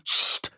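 cutting "psst" hiss; sharp, voiceless hushing sound; sharp hissed shush. A narrator's reading. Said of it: "zischte Liza wieder".

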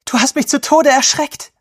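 high, loud, upset reproach; upset outcry. A narrator's reading. Sobbing girl to Daniel who scared her, upset outcry. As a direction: cry it out at him, shaken and high-pitched.

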